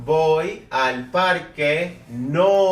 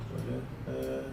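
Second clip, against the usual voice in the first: talking to oneself; 2 semitones lower, 15 dB quieter than usual. Real, not acted. Teacher searching the screen, quiet talk to self. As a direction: say it absently under the breath.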